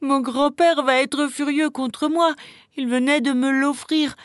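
put-on high, drawn-out whimpering boy; tearful child. A narrator's reading. Boy dreading his grandfather's anger, tearful. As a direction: wail it as a sobbing little boy. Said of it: "il sanglota de plus belle".

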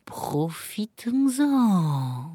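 slow, drawn-out sly cat; scheming cat to himself. A narrator's reading. Cat seeing duck and bird squabble, slyly to himself. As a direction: stretch it out as a private plan. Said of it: "il se dit".

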